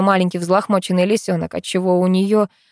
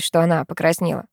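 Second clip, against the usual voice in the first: plain reading voice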